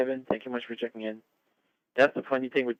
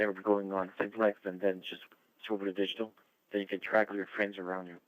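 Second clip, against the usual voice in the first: ordinary talk, nothing marked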